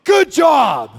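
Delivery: shouted praise. Retold or imitated. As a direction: snap it like a drill coach. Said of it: "And she goes".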